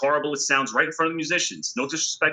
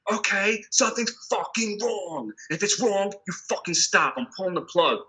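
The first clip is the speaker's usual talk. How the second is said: fed-up ultimatum; 6 semitones higher than usual. Retold or imitated. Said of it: "I was like".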